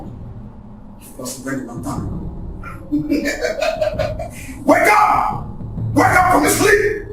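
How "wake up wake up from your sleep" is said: shouted wake-up call. Acted. Yelling at friend to wake.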